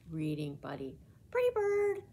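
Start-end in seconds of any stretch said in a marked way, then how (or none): 1.3-2.0 high, loud parrot voice; parrot squawk. Acted. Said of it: "squawked Pickles".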